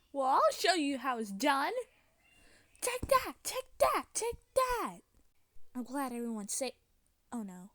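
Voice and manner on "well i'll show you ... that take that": loud battle cries rising high; play-fight shouting. Pretend. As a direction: shout each blow in a pretend fight. Acted fight with the villain.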